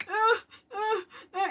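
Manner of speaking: put-on high whimpering cries like a stuck toddler; baby's scared whimper. Mom mimicking her scared baby's high whimper. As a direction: imitate the baby crying out. Mother imitates crying stuck baby.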